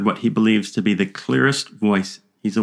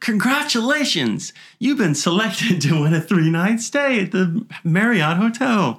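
higher, drawn-out sales pitch voice; mock prize announcer; 10 semitones higher, slower than usual. Joking that the ghost phone is a telemarketer, mock announcer voice. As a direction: ham up a cheesy prize-call announcer.

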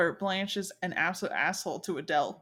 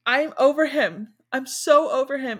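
hammed-up heartbroken insistence; mock tearful insistence; 5 semitones higher, 8 dB louder than usual. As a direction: imitate a crying friend protesting too much. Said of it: "she's just crying".

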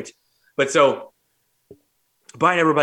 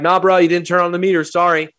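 normal talking voice

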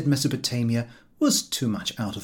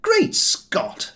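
high, loud startled cry; shocked exclamation; 5 dB louder than usual. A narrator's reading. George aghast at having to work, surprised outcry. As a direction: cry out in mock outrage.

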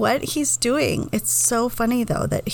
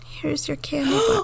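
high, squeaky voice for the dog; cutesy dog voice; 5 semitones higher than usual. Retold or imitated. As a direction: voice the dog like a sweet pup. Dog voiced in retold story.

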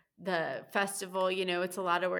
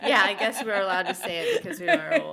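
laughing through the words, louder and higher; 6 semitones higher, 8 dB louder, faster than usual. Real, not acted. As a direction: giggle it out while joking. Joking about being old.

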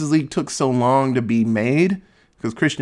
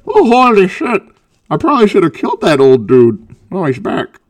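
louder, higher put-on Bane, alarmed; 5 semitones higher, 7 dB louder than usual. Bane spotting the bat symbol, loud mock alarm. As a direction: bellow it like a startled Bane. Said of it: "He's like".

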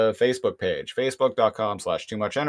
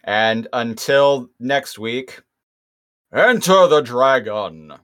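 announcer sign-off; 5 dB louder, slower than usual. Acted. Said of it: "show sign-off catchphrase".

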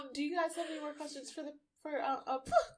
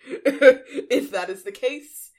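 laughing; 22 dB louder, much slower than usual. Real, not acted. Laughing protest at roll.

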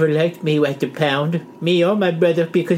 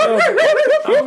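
laughing; 4 dB louder than usual. Real, not acted. Group cracking up.

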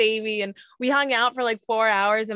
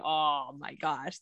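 awed hush; 6 semitones lower, 9 dB quieter, slower than usual. Retold or imitated. Awed by the newborn moment.

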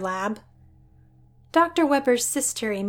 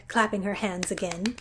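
usual speaking voice

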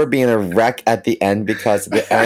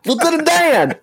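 high, ringing call of the name; Forrest calling out. Retold or imitated. Quoting the movie to his friend, a high ringing call. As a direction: call out like Forrest across the field.

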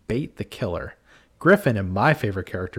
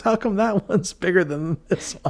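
teasing, laughing; 9 semitones higher than usual. Retold or imitated. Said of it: "he's pointing to the fake breast".

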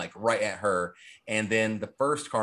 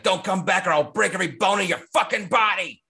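put-on a Brooklyn accent, a bit higher and louder; 7 semitones higher, 4 dB louder than usual. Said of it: "like this Brooklyn accent".

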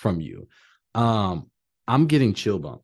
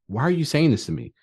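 normal talking voice

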